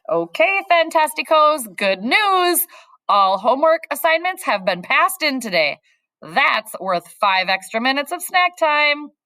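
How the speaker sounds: rally the class with good news; cheery teacher. A narrator's reading. Teacher announcing extra snack time, cheery.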